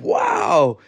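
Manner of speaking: very high, drawn wow; amazed wow. Retold or imitated. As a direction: act out being blown away, pitch shooting up. Retold amazed reaction in his mind.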